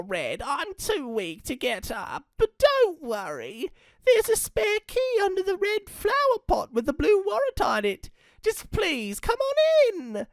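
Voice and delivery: put-on apologetic old gran; fussy granny voice. Gran voice held.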